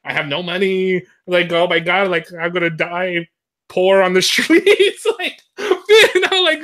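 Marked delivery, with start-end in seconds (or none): 3.7-6.6 high, slow giggling through the words; half-laughing, drawn out. Real, not acted. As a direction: laugh at your own dread. Laughing over dying poor.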